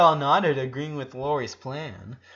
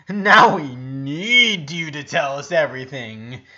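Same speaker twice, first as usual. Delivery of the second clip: loud, slow, insistent; excited demand; 11 dB louder, slower than usual. A narrator's reading. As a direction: push him to spill everything. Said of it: "shaking with excitement".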